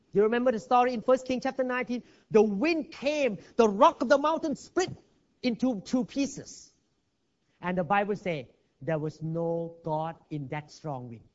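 ordinary talk, nothing marked

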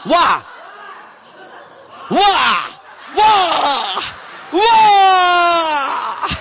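Chinese wah. Retold or imitated. Preacher imitating a Chinese speaker's amazed wah. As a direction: build the wah up to a cry. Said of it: "How Chinese say".

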